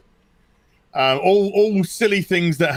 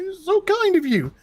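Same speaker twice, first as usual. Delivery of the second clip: high, choked-up voice of a grateful mother; a touch of tears, much higher pitch; tearful mum. Retold or imitated. Mother moved to tears by her son's gift, tearful and high. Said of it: "She was like".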